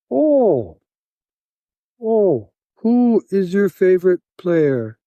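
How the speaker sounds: high, swooping ohs, then slow question; imitated delighted captor. Captor quizzing the Canadian on hockey, high and slowly intrigued. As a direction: play the impressed captor, high and drawn out.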